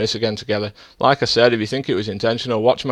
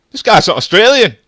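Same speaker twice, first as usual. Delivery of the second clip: incredulous; 9 semitones higher, 7 dB louder than usual. Real, not acted. Incredulous outburst in racing argument.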